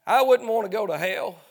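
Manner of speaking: loud, driving revival sermon; fiery preaching. Acted. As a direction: preach it hard to the sinners. Preaching hellfire at full force.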